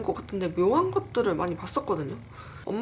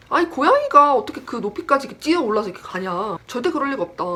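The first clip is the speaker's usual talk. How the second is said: louder, higher retold objection; heated protest; 5 semitones higher, 7 dB louder than usual. Child insists to mother it was no cat, protesting loudly. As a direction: argue back indignantly, voice raised.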